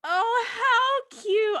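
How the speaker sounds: high, loud, drawn-out coo; excited gushing. Real, not acted. Fan excited for the wedding, loud gushing coo. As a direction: squeal it slowly with delight. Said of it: "I'm very excited for the wedding".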